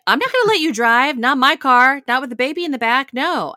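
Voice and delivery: hammed-up stern refusal; mocking angry wife. Wife refusing to let husband drive, a loud mock scolding. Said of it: "she's like".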